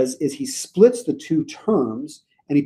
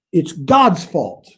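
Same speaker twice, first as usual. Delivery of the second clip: ordinary talk, nothing marked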